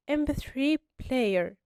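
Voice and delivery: slow, careful model of إم بي ثري player; slow demonstration. Shown as a demonstration. Teacher showing students how the word begins, slowly. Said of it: "أول حرف نطقناه".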